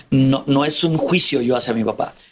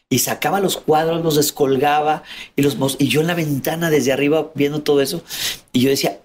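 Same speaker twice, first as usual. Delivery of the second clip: usual speaking voice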